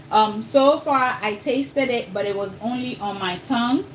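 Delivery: normal talking voice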